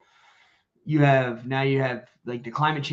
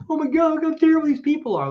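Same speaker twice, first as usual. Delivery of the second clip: much higher, louder mock outrage; whiny mock victim; 14 semitones higher, 6 dB louder than usual. Host imitates a protester complaining to the camera, high and loud. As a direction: play the protester crying foul for the camera.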